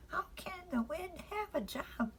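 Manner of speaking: high, small questioning voice; a child asking innocently; curious little girl. Acted. Frances asking Father why the curtains move.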